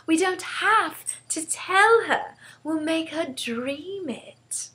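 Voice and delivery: put-on high, slow and drawn-out girlish delight; eager child stretching out the secret; excited reveal. A narrator's reading. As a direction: unveil the clever plan slowly, savouring it. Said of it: "Sophie said excitedly".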